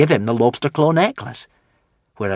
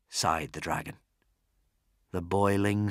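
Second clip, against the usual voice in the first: usual speaking voice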